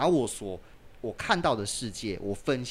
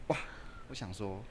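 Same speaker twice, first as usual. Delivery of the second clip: ordinary talk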